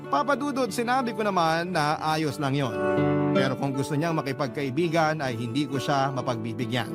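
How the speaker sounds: ordinary delivery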